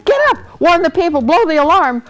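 high, loud wake-up yell; urgent shout. A narrator's reading. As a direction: shout to rouse a sleeper. Waking the sleeping trumpeter urgently.

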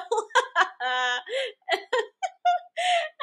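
bursts into a high shrieking laugh; can't hold in the laughter; big high laugh. Real, not acted. Cracks up at the tweet.

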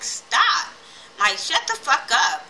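sharp high retold "Stop!" then a hard cut-off; fed-up yell. Hannah snaps at the mother-in-law, fed-up yell. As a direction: snap at her to shut up, imitating Hannah.

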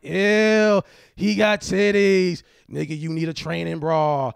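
mocking schoolgirls. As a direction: tease him like a mean little girl. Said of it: "They was like".

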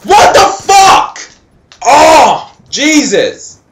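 high, loud frustrated outburst; real yelling. Real, not acted. Gamer rages at an unfair miss, yelling. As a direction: yell it out, easing off toward the end. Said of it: "Sorry if I'm yelling".

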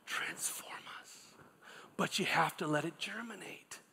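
dropped to a whisper; whispered emphasis. Acted. Pastor hushing the congregation to drive home his point, whispering.

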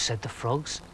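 ordinary delivery

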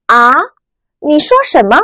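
啊 rising like a puzzled question. Shown as a demonstration. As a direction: ask 啊 with a rise, not catching it. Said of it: "疑问的语气". (啊，第二声，疑问语气)

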